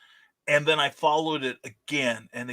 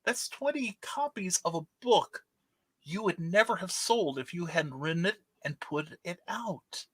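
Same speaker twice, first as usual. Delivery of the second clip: lightly voiced friend, higher and softer; softer, higher voice of a wise friend; a hint of a wise friend; 4 semitones higher, 6 dB quieter than usual. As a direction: friend gently setting him straight. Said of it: "he said".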